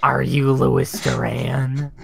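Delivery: slow, loud, drawn-out question; hammed-up slow menacing ask; mock creepy greeting. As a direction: stretch the question out as a joke. Friend mimicking the creepy greeting.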